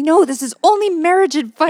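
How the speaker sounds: high, loud mock protest; whiny indignant quote; imitating upset. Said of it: "you got so upset with me".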